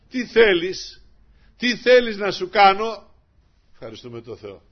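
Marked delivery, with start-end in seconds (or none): 0.1-3.1 higher, louder, slow calling question; as the Lord, call out the offer. Acted. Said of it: "Έτσι μας λέει ο Κύριος". (ως ο Κύριος, δυνατά και αργά)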